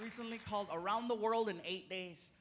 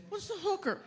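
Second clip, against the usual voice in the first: put-on high innocent child; kid voice; 11 semitones higher than usual. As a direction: ask it like a curious little boy. Son voice again.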